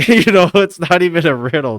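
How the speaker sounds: laughing. Real, not acted. Laughing after mock riddle.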